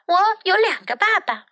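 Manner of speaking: put-on high, quick little girl. A narrator's reading. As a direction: pitch it up like a small girl. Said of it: "伊丽莎白说".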